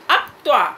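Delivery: अक्त्वा with the अ clearly voiced first; pronunciation demo. Shown as a demonstration. Teacher modelling the right sound for the class. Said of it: "इसका pronunciation ऐसे कीजिए".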